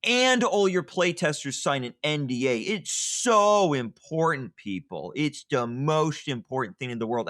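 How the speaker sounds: mock-earnest, over-the-top sarcasm; sarcastic. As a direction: pretend it's life or death, dripping sarcasm. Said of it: "and I'm being sarcastic".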